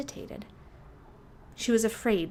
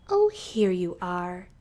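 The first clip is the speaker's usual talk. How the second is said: a big grown-up voice from behind; grown-up snake's voice; 7 dB louder, slower than usual. A narrator's reading. Mother snake finding her son, slow and looming.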